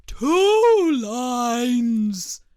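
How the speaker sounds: loud, drawn-out call; big booming storybook exclamation; loud cry. A narrator's reading. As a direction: cry it out as the Mock Turtle. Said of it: "cried the Mock Turtle".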